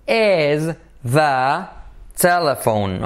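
ordinary delivery

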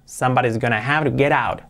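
normal talking voice

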